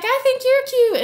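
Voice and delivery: high, coy flirty reply; high coy flirting; Cleopatra flirting. Retold or imitated.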